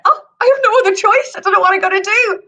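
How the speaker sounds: high, hurried mock-helpless protest; flustered giant. Giant resigned to wife's desperate plan.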